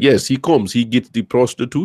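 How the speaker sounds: a clumsy, hammed-up Russian accent; bad Russian accent. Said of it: "That's a terrible accent".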